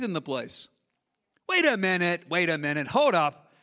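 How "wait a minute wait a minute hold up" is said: ordinary talk, nothing marked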